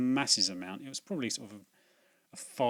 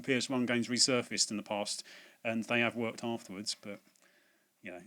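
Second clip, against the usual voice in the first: usual speaking voice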